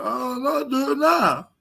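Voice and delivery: high mock-crybaby voice; hammed-up, high-pitched pleading; whiny rival impression. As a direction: imitate him whining, about to cry. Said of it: "Sound like he about to cry".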